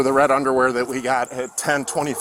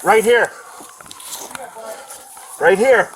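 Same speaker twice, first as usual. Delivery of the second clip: loud call; 7 semitones higher, 4 dB louder than usual. Real, not acted. Officer directing partner.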